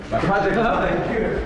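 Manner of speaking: the horror sinks in; panicked realization. Acted. Student realises project is gone.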